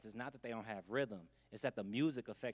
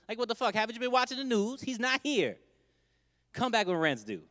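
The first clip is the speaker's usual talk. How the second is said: higher and louder, fed-up tenant shouting; loud, high, irritated rant; mock exasperated yell; 9 semitones higher, 8 dB louder than usual. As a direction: holler at the protesters. Protesters shouting at Trump Towers.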